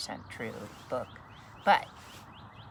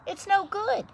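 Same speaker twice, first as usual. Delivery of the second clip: sad; 9 dB louder than usual. Acted. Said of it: "said everyone sadly".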